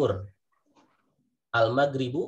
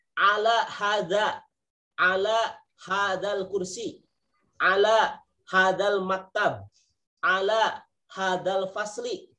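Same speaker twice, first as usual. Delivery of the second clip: slow, drawn-out Arabic examples, higher; slow demonstration; 6 semitones higher, slower than usual. Shown as a demonstration. Teacher slowly demonstrating Arabic phrases to students. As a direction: spell each phrase out slowly. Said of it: "Ustadz, ada ala sebelum hadza".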